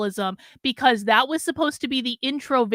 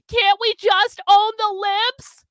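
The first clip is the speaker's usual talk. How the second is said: mocking whine; 7 semitones higher, 5 dB louder, slower than usual. Mocking the guest's eagerness.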